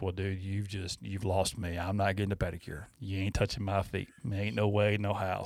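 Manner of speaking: low, soft retold protest; deadpan refusal. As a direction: refuse under your breath, drawing a firm line.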